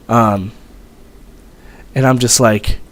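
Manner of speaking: ordinary talk, nothing marked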